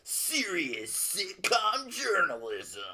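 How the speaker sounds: high, slow mock-serious announcing; drawn-out, put-on grand journalist voice; serious journalism voice. Co-host parodying himself on request, mock serious journalism voice. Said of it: "your serious wrestling journalism voice".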